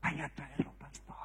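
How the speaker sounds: imitated quiet plea, fading away; retold hushed plea. Mother asking the pastor to fetch her son, hushed. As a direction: drop very soft, fading to a near-whisper.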